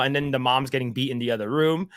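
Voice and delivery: normal talking voice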